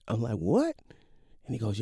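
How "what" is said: high, sharply rising "what?"; incredulous what. Retold or imitated. Son hearing the car was coasting, incredulous. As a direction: squeak it upward in disbelief. Son reacts in disbelief to story.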